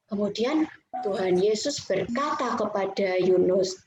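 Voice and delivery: ordinary delivery, nothing marked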